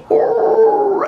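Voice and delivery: long, high hound howl; imitated dog howl. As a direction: howl loudly like the neighbor's beagle. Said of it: "this dog is howling".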